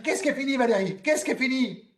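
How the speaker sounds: high yelled question; desperate yell. Retold or imitated. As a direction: yell it into the sea. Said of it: "je hurle dans la mer".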